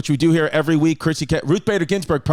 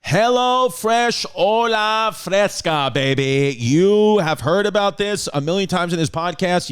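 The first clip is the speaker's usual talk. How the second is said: big, drawn-out high ad opening; showy podcast sponsor kickoff; ad read; 4 semitones higher, slower than usual. Acted. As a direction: belt out the sponsor's name like a hype announcer.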